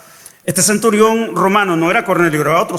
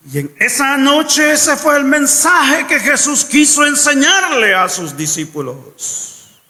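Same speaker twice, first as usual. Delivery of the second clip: ordinary talk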